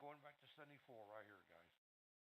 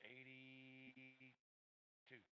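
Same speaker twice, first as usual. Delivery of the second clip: EIGHTY held very long, then TWO after it; long suspended hold on "eighty"; drawn-out word; 3 dB quieter, much slower than usual. Real, not acted. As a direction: stretch out "eighty" before landing on "two". Drags out the target while charting.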